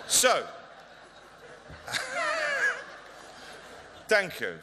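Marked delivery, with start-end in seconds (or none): 1.7-2.9 squeaky high giggle; real high laugh. Real, not acted. Speaker laughing at his own joke, high giggle.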